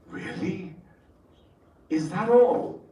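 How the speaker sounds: incredulous professor question; a touch of amused disbelief; mock surprise.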